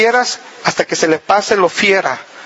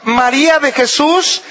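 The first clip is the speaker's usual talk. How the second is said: raised, emphatic parental summons; stern full-name call; 5 semitones higher than usual. Shown as a demonstration. Parent calling a daughter by her full name, stern. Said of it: "cuando el nombre es completo".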